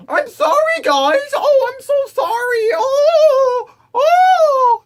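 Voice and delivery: shrill mock weeping and wailing; mock sobbing apology. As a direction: ham up a blubbering apology. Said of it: "watch your dumb ass going".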